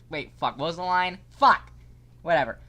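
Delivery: ordinary delivery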